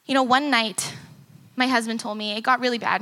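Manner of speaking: ordinary talk, nothing marked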